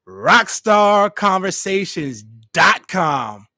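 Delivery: slow, loud announcer shout; hyped shout. Pretend. Host hyping his podcast website with a loud announcer shout. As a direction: belt the web address like breaking news. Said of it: "Breaking news".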